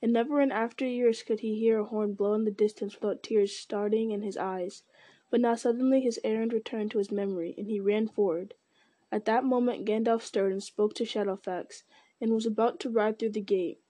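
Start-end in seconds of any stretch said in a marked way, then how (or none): none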